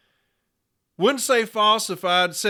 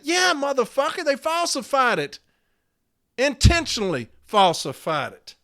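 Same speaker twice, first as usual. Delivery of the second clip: high-pitched angry comeback; indignant retort; 6 semitones higher than usual. Real, not acted. Member answering the leader's excuse about training, indignant. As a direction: leap up high to throw it back.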